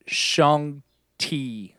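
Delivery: "Shang-Chi" with a T sound in "Chi"; mispronounced name. Real, not acted. Fumbling the film hero's name, "Shang-Chi" slowly said as "Shang-Tee". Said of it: "I still can't get it right".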